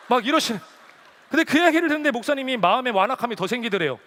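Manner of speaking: ordinary talk, nothing marked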